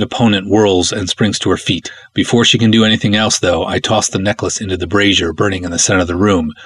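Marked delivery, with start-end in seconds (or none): none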